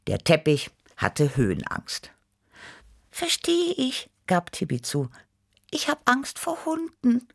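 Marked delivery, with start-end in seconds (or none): 3.1-4.1 very high kitten voice, sympathetic; high-pitched understanding kitten; Tibi Tiger. A narrator's reading. 5.7-7.3 very high kitten voice, confessing; high-pitched confiding kitten; Tibi Tiger. A narrator's reading.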